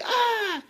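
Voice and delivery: high, unhinged mock shriek; a quick hammed-up crazy yell; crazed mock scream. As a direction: shriek like she has snapped. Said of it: "she goes all crazy again".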